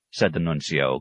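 plain reading voice

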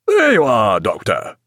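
hearty call, dropping on the last word; opening called out, then falling away; captain's cry. A narrator's reading. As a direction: call out to greet someone on deck. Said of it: "cried the captain".

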